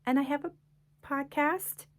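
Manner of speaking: high, tentative, rising as if asking; sheepish admission. Retold or imitated. Author admitting to snarky people, sheepish and tentative. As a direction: admit it hesitantly, lifting each phrase like a question. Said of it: "And I'm like".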